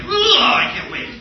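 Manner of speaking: high, loud drawn-out squeal; over-the-top excited shout; giddy anticipation. Acted. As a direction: gush it loudly, hardly able to wait. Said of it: "We're both like".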